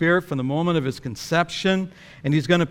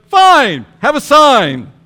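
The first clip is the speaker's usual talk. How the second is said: much higher, louder, fed-up snap; exasperated retort; 9 semitones higher, 12 dB louder, slower than usual. Retold or imitated. Gabriel fed up with Zechariah's doubt, snapping loudly. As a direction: throw the sign at him in exasperation. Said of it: "And so Gabriel goes".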